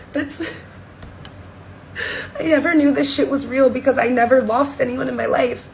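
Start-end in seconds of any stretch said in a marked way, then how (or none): 0.1-3.7 laughing and crying. Real, not acted. Overwhelmed recalling dead friend.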